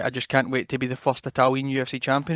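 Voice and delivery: normal talking voice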